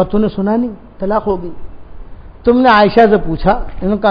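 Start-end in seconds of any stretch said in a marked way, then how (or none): 0.0-1.5 softer, grieving reply; grieving friend, subdued; tearful. Retold or imitated. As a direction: answer through sorrow. Weeping Abu Bakr answers.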